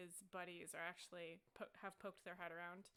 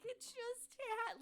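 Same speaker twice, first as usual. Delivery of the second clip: high, loud, drawn-out wail; hammed-up exasperated whine; frustrated protest; 5 dB louder, much slower than usual. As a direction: insist helplessly, at wit's end. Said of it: "he's so frustrated".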